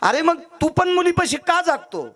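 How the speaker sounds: very high, drawn-out challenge; incredulous questioning. Acted. Saint asking the father why he stays awake, incredulous. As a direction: demand it in high-pitched, slow disbelief.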